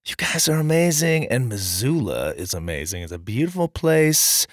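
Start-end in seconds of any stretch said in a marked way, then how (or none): none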